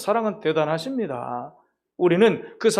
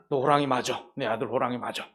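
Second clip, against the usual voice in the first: soft fatherly reassurance; 7 semitones lower, 7 dB quieter than usual. Acted. Father tiger soothing the cub.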